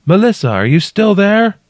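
high, loud call to someone trapped. A narrator's reading. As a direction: shout to check she is there. Anxious call down after tremor.

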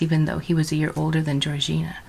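plain reading voice

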